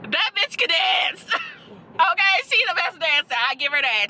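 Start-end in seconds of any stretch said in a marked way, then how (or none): 0.1-1.4 higher, drawn-out exclamation; emphatic praise. Real, not acted. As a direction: stretch it out in delighted emphasis. Repeats praise, building to exclamation.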